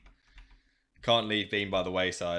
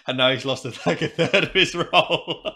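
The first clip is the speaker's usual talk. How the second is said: laughing; 6 semitones higher, 10 dB louder than usual. Real, not acted. Laughing at friend's loss.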